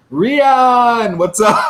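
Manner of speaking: high, loud drawn-out greeting; calling out. Real, not acted. Host greets viewer Rian on stream, loud high call-out. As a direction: belt out the name in a big hello.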